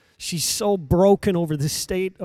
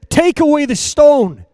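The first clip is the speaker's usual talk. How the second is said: loud, higher preaching command; 6 semitones higher, 8 dB louder than usual. Acted. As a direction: proclaim it at full force. Jesus commands at the tomb.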